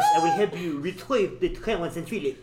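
put-on light French kid accent. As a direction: say it like a French boy. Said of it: "he's, like, French".

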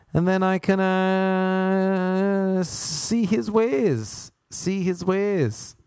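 high, slow, drawn-out mystic chant; mystical guru voice. Pretend. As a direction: intone it like a spiritual seeker. Mystical parody with music.

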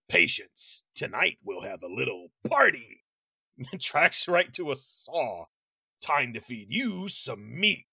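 lightly voiced low menacing killer; a hint of sinister Freddy; creepy villain voice. Voicing Freddy's menacing lines.

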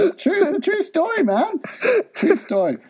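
very high silly voice; mock insistence. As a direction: squeak it out as mock-earnest assurance.

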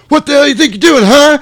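very high, loud mock-angry yell; badly overacted outburst. [name] jumps up from the ground, hammed-up angry yell. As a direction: ham it up as a terrible furious actor. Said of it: "this is [name]'s worst delivered line".

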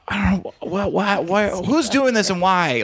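high, stammering hurt voice; hurt comic. Retold or imitated. Comic wounded by the heckler, stammering high and hurt. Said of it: "you could see the hurt in him".

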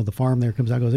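ordinary talk

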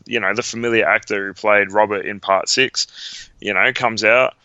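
normal talking voice

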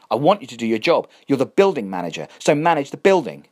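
rising to a sharp angry shout. Acted. Catherine scolding the building manager, voice climbing to anger. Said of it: "She hadn't meant to raise her voice".

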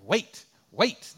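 loud, mechanical robot voice, rising at the end; imitating the crosswalk box he hates. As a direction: bark it like a crosswalk machine. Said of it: "That's the voice I hear".

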